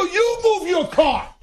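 high, slow indignant comeback; to the shopper in the aisle, high and slow; mock angry retort. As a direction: fire it back at her, drawn out. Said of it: "I go".

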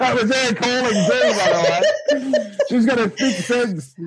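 amused, laughing as you explain. Real, not acted. Laughing about the wife's call.

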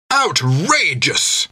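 slow, high indignant exclamation; outraged. Acted. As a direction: stretch out the outrage. Whistle captain protests.

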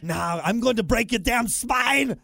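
high Austrian strongman shout; Arnold. Acted. Host topping a co-host's threat, shouted Arnold impression. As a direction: shout it as an Austrian action hero. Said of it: "you haven't done Arnold in a while".

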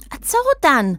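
high-pitched urgent cry; acted high-voiced shout; Zan calling out. A narrator's reading. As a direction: call out high to stop them. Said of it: "קראה זן".

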